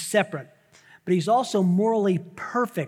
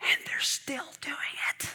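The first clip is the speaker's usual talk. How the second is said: awed whisper; 7 semitones higher, 6 dB quieter than usual. Acted. Awed aside after shouting.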